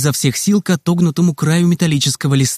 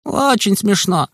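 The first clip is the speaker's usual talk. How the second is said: slow, dry sarcasm; sarcastic; 6 semitones higher, slower than usual. Acted. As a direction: drag it out, unamused. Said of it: "раздалось за моей спиной". (медленно и саркастично)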